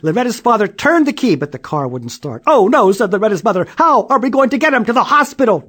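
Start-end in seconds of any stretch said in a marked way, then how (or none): 2.5-5.6 high, loud alarmed mother; panicked mother. A narrator's reading. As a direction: fret in a rush.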